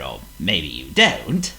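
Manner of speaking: ordinary delivery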